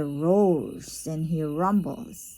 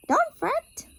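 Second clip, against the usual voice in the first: much higher squeaky mouse voice. Acted. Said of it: "The mouse squeaks".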